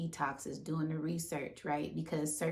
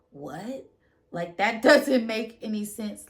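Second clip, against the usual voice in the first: loud, higher laugh through the words; laughing disbelief; 5 semitones higher, 10 dB louder than usual. Real, not acted. Laughing to viewers that the dream makes no sense. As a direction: burst out laughing at the idea.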